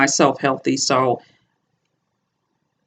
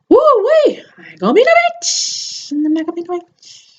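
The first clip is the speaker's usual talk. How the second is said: silly high voice. Acted. Shaking off emotion, silly voice.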